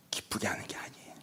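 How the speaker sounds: defiant whispered aside. Retold or imitated. Believer muttering defiance at God, whispered. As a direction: breathe the words out with no voice. Grudging believer talking back.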